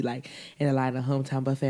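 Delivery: ordinary talk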